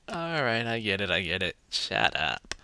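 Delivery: annoyed mock reaction. Reacting to the repetitive movie, mock fed-up and low. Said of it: "which kind of was like".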